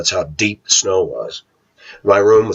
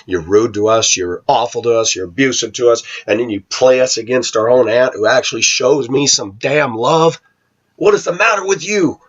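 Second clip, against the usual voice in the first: imagined tirade, climbing from usual pitch to high indignation; building outburst; 3 semitones higher than usual. Retold or imitated. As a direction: let the reproach mount higher and higher.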